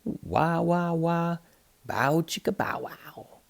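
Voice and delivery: high sung wah-wah of a steel guitar; playful high guitar-noise vocalizing; guitar twang imitation. A narrator's reading.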